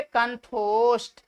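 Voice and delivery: slow, syllable by syllable कंठोष्ठ; slow spelled-out term. Shown as a demonstration. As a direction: stretch कंठोष्ठ out slowly. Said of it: "क्या बन गए". (धीरे धीरे कंठोष्ठ)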